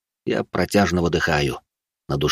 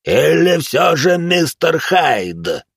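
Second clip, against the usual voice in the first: slow, high-rising monstrous roar; roaring monster; 8 semitones higher, 4 dB louder, much slower than usual. Acted. Monster seizing the lad reveals himself, roaring. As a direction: roar the name out, drawn long.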